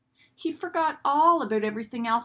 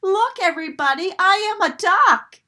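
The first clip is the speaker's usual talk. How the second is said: high, loud, fast call; excited shout; 11 semitones higher, 10 dB louder than usual. A narrator's reading. Duckling calling to the whole pond, high excited shout. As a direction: shout joyfully to everyone. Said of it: "he shouted".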